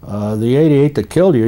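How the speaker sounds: ordinary talk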